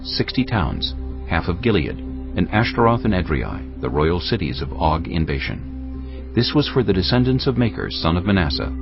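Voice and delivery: ordinary talk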